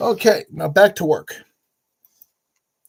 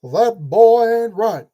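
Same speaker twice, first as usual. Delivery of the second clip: put-on slow, louder Texan drawl, boy stretched; Hank Hill southern drawl; 2 semitones higher, 6 dB louder, slower than usual. Building toy, quoting Hank Hill in slow Texan drawl. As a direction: drawl it out slowly like a Texas dad. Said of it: "into Hank Hill".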